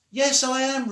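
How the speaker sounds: high, loud call across the street; child calling to her friend across the street. Acted. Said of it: "They had to use loud voices".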